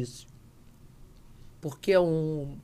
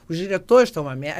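louder rant; 3 semitones higher, 10 dB louder, faster than usual. Retold or imitated. Director ranting about bad scenes.

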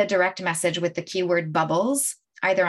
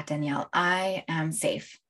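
calm affirmation; 3 semitones lower, 6 dB quieter than usual. Shown as a demonstration. Coach recalling affirmations before the jump, calm and soft.

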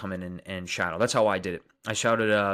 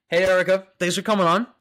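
excited greeting; 9 semitones higher, 8 dB louder than usual. Real, not acted. Greeting a live chat guest.

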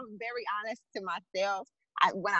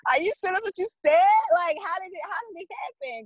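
higher, quicker disbelieving questions to God; rising, disbelieving plea to God; incredulous questioning; 8 semitones higher than usual. Retold or imitated. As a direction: ask God in mock disbelief.

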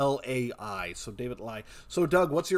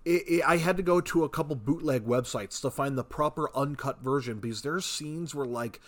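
ordinary delivery

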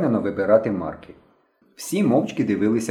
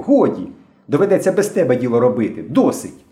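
raised, louder exasperated reprimand; angry scolding; 5 semitones higher, 4 dB louder than usual. A narrator's reading. Myshko telling Henka he is off the job, angrily. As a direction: lay into a friend who spoiled the plan.

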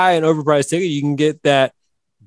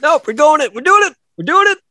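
excited; 12 semitones higher, 5 dB louder than usual. Real, not acted. Insists on keeping bit going.

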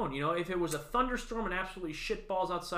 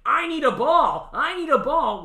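high, loud mock yell, stretched out; mock bearded fan yelling; 10 semitones higher, 12 dB louder than usual. Mocking a bearded fan shouting at the bat boy, loud and high. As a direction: play a grown man demanding a ball like a kid. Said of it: "and is like".

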